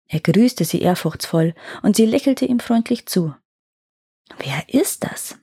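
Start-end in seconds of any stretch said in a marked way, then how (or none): 4.4-5.4 slow, voiceless whisper; musing whisper. A narrator's reading. Lady wonders about the hunter she met, musing whisper. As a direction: whisper it as a private thought. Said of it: "überlegte sie".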